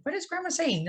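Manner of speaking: a hint of her four-year-old self, confused; confused little girl.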